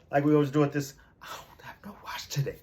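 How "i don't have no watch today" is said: whispered aside. Real, not acted. Aside, unvoiced.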